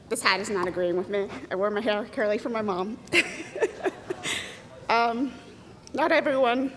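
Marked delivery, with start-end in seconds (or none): none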